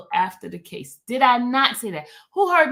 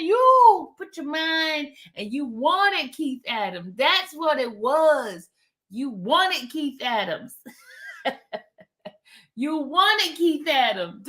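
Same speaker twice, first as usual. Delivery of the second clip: slow, deliberate needling; drawn-out teasing; 4 semitones higher, slower than usual. Pretend. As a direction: spell it out slowly to tease.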